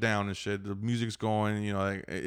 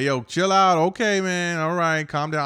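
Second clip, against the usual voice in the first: louder, higher chill-out appeal; placating; 6 semitones higher, 6 dB louder than usual. Retold or imitated. Driver with his hand out to the yeller, placating. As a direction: call across to settle someone down. Retold plea, hand held out.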